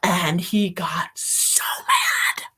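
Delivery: a hushed, juicy aside; slow secret telling; whispered gossip. Real, not acted.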